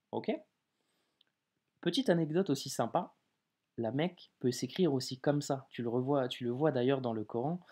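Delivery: ordinary delivery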